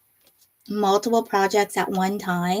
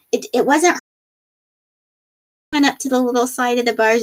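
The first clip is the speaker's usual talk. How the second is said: usual speaking voice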